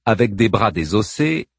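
plain reading voice

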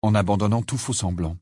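ordinary delivery, nothing marked

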